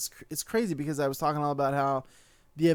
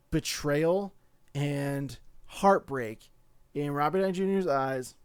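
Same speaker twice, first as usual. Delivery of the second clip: ordinary talk, nothing marked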